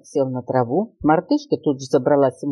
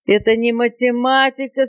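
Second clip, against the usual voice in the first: high-pitched indignant exclamation; outraged parrot cry; 6 semitones higher than usual. A narrator's reading. Parrot objecting to the monkey's plan, outraged. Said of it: "закричал попугай".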